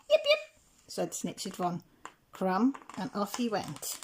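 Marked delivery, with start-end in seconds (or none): 0.1-0.4 very high, louder squeaky yips; tiny dog yips. A narrator's reading. Snitzer von Crum answers the cat, shrill high yips. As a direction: yip like a tiny sausage dog.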